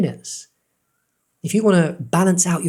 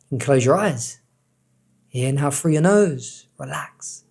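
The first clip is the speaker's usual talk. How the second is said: guided meditation. Acted. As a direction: ease the listener into calm breathing. Leads breathing meditation.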